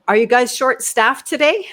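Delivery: loud, high, pointed question; said kinda loud. Retold or imitated. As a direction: call it out across the bank. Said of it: "I said it kinda loud".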